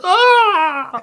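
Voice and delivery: sharp shrieking cry of pain. Acted. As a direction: yelp as you're smacked in the face. Old lady hit in face.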